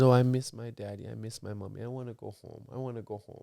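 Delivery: soft, murmured imitation of a pleading kid; homesick child. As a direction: plead quietly, over and over, as a mimicked child. Grandmother quoted voicing homesick grandchild.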